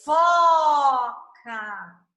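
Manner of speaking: "Foca" drawn out long, loud and falling; loud, elongated "Foca", sliding downwards; stretched sound. Shown as a demonstration. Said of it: "Eu estico esse som".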